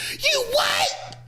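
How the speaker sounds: very high shrieking falsetto; hammed-up high-pitched spousal outrage; outraged wife. Acting out wife's outraged reply.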